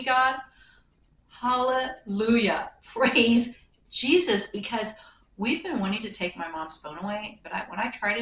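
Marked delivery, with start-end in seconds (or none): 0.0-4.5 louder and higher, slow drawn-out Hallelujah; joyful praise. Real, not acted. Relieved daughter thanking God the phone died, loud slow joyful praise. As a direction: shout thanks to heaven, stretching every word.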